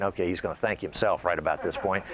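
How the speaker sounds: low, soft mock inner voice; a hint of a sly audience member; lightly voiced audience thought. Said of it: "and you're thinking".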